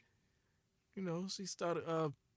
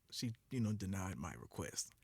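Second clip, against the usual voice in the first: ordinary talk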